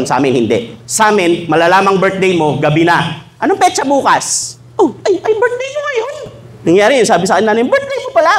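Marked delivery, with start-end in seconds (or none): 3.4-6.1 mock surprise. Retelling forgotten birthday at home. 7.6-8.3 high, startled mother's question; mother's surprise. Retold or imitated. Mother just realizing it's her son's birthday, surprised and high.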